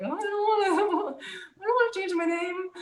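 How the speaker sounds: high, whiny mock cry through a laugh; whiny resistance. Mock whine resisting the new name, high and teary. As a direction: whimper like a child refusing. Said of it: "I had a lot of resistance".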